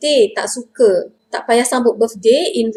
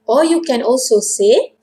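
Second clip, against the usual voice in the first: normal talking voice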